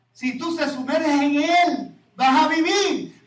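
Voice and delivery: slow, rising fervent declaration; slow emphatic promise. Acted. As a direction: drag out each word of the promise. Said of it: "emphatic promise".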